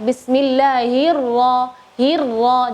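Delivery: bismillahirra said slowly with a trilled ra; over enunciating. Shown as a demonstration. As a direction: bismillahirra drawn out, ra rolled clearly. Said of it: "harusnya lebih jelas lagi getaran huruf ra-nya".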